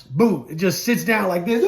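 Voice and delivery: ordinary talk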